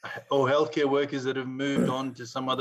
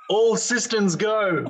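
slow, louder, amused; laughing; 7 semitones higher, 5 dB louder, slower than usual. Real, not acted. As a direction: savor it slowly with a laugh. Savoring the pun name.

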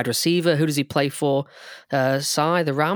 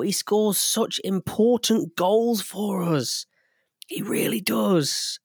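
whisper; 7 semitones higher, slower than usual. Real, not acted. Fan gushing about favourite player.

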